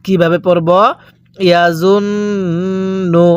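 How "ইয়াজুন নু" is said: ইয়াজুন নু with a long hummed n; ইয়াজুন নু recited with a sustained nasal n; nasal ghunnah. Shown as a demonstration. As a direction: hold the n of ইয়াজুন through the nose before নু. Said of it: "গুনাহ করে পড়তে হবে".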